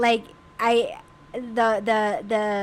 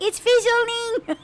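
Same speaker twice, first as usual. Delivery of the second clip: mock panic; 10 semitones higher, slower than usual. Retelling panicked ear scare.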